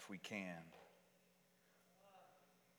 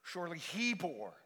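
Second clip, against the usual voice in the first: loud, high burst on HE; emphatic preaching; 9 semitones higher, 7 dB louder than usual. Real, not acted. Preacher proclaiming who bore our sufferings, emphatic and raised. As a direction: proclaim it, voice leaping up and louder.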